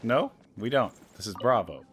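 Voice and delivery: ordinary talk, nothing marked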